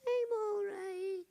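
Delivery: put-on high, slow, tearful little girl; a small child near tears; little girl sniffling out her answer; sad little girl. Child answers sadly on phone.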